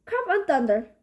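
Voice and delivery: plain reading voice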